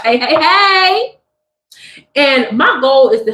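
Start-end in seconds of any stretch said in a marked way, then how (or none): 0.0-1.2 drawn out, slow, higher hey; sing-song teasing hello; playful hey. Real, not acted. Playful sign-off greeting.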